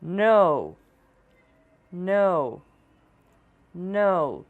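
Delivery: no with a rounded ow glide; English no. Shown as a demonstration. Teacher models English no for learners, rounded glide. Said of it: "Vamos tentar repetir três vezes".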